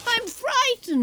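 high, thin frightened plea. Acted. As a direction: beg him to stay, scared. Pleading, doesn't want him to leave.

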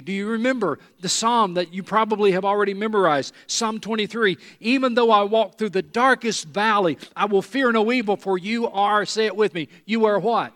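loud, high scripture recital; impassioned preaching. Real, not acted. Preacher leads congregation in Psalm twenty-three, impassioned preaching. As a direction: recite it forcefully, rallying listeners to join.

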